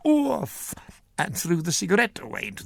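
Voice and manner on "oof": high startled yelp of surprise; startled oof. Retold or imitated. Priest startled by his own cigarette, high yelp. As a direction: yelp as you spot the cigarette.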